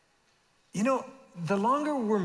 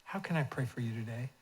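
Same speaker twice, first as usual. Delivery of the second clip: soft asking; 4 semitones lower, 7 dB quieter than usual. Shown as a demonstration. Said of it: "just ask this question".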